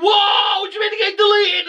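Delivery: very high, fast panicked shout; mock panic. Boss reacting to the bad news in a squealing panic. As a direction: squeal in exaggerated alarm.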